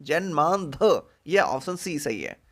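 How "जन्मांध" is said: जन्मांध said slowly three times; slow repeated drill. Shown as a demonstration. Teacher repeating the right answer slowly. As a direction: let each जन्मांध sink in, slow. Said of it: "option सी पे ध्यान रखिए".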